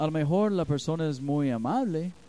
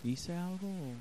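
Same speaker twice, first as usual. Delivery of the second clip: soft, higher, sincere apology; 5 semitones higher, 7 dB quieter than usual. Shown as a demonstration. Pastor models how to answer a spouse, softly sincere. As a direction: say sorry gently and mean it. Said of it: "en un tono sincero".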